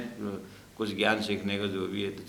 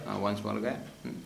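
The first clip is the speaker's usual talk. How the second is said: ordinary delivery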